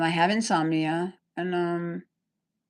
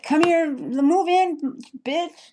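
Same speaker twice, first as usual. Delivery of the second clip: mocking; 10 semitones higher, 3 dB louder, slower than usual. Mocking the friend's invitation.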